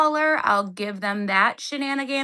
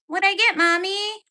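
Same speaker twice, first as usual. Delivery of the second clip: mock child voice; 8 semitones higher than usual. Kid voice asking mommy.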